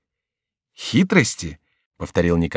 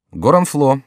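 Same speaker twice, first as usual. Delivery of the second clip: usual speaking voice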